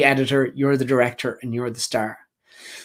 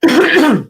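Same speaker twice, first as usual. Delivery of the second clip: sharp throat clear; 12 semitones higher, 5 dB louder than usual. Real, not acted. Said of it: "Sorry, frog in my throat".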